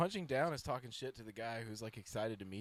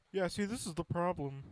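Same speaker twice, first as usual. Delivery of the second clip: mock voice-actor Kermit; 8 semitones higher, 3 dB louder than usual. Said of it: "Kermit voice".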